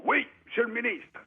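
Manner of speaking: high, clipped soldier's reply to a superior; soldier answering. Retold or imitated. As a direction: bark it up high like a para at attention. Para snaps answer to minister.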